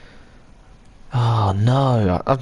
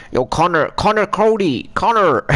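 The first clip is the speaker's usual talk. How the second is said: put-on Irish accent, pitched up; hammed-up, higher-voiced Irish accent; 6 semitones higher than usual. Shown as a demonstration. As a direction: call out in a jokey Irish accent. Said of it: "I'll do my Irish accent".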